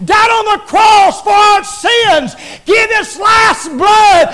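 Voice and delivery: loud, high, slow hammering sermon cry; shouting preacher driving each phrase home; full-force preaching. Acted. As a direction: preach it out at the top of your voice.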